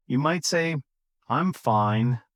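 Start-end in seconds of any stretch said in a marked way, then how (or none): 1.3-2.2 low-pitched bad-day reply; flat, low, dejected. Shown as a demonstration. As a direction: say it flatly, as after a bad day. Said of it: "Flat, low, falling".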